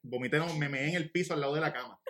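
ordinary delivery

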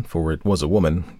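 ordinary delivery

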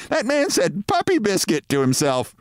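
a passing woman tickled by what she heard; amused woman. A narrator's reading. Woman to her companion, high amused voice.

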